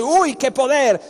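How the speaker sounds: high, loud mock amazement; mock awe. Preacher mimicking a dazzled congregation, in mock awe. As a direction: gasp it like the dazzled crowd.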